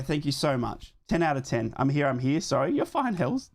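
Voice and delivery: normal talking voice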